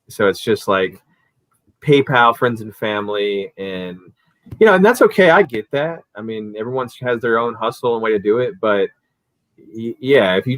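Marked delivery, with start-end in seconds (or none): 4.6-6.0 conceding. Real, not acted. Concedes point.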